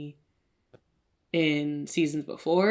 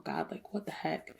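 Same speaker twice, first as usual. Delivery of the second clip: soft retold prayer; 9 dB quieter than usual. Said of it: "I was like".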